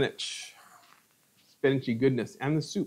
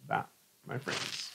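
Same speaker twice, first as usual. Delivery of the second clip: hushed; 6 semitones lower, 9 dB quieter, slower than usual. Shown as a demonstration. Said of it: "flavors mixed together".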